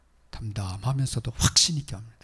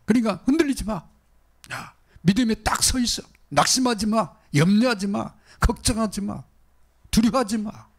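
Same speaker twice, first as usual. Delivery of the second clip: high, loud, insistent exhortation; preacher voicing God, urging no fear; voice of God commanding; 11 semitones higher, 4 dB louder than usual. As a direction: thunder the commands one after another.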